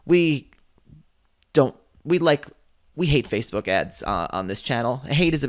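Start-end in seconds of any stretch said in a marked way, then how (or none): none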